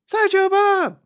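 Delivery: high, loud shouted name; shouting. Retold or imitated. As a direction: yell the name across the square. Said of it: "We get the classic shouting".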